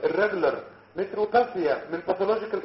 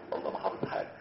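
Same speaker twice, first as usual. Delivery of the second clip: whispered aside; 8 dB quieter, faster than usual. Real, not acted. Joking aside to the class.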